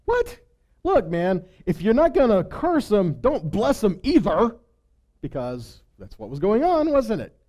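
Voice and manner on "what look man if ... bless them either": high, indignant king's outburst, slowing on the last words; exasperated Balak. Retold or imitated. Preacher voicing Balak scolding Balaam, exasperated and high. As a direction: throw up your hands at the prophet. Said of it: "Balak's response?".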